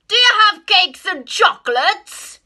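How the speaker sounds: loud, high eager cry; eager rat. Acted. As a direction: cry it out greedily. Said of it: "the highway robber cried".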